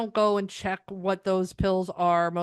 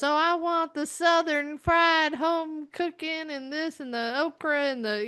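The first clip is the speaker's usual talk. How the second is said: mock Southern accent; 6 semitones higher than usual. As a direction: parody an over-the-top Southern diner. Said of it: "really ridiculous".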